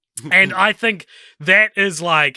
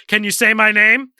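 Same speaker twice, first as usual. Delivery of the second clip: mock American accent; 6 semitones higher, 6 dB louder than usual. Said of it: "you put on an American accent for a character".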